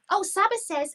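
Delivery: ordinary talk, nothing marked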